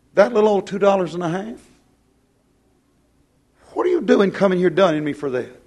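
insulted. Retold or imitated. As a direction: play the offended debtor brushing off the bill. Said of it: "he'd act like he was insulted".

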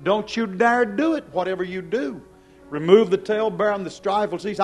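usual speaking voice

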